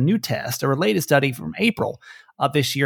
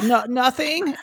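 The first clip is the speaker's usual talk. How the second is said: flustered denial; 10 semitones higher, slower than usual. Retold or imitated. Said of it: "And she was like".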